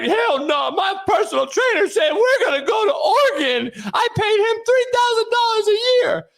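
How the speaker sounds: mocking an outraged mother, pitched high; indignant mom. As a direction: squawk back like an offended mom. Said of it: "The mama's like".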